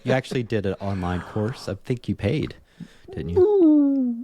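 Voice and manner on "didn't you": whale speak. Pretend. Teasing co-host with mock whale speak.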